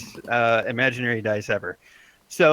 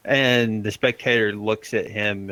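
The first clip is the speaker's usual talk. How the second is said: ordinary talk, nothing marked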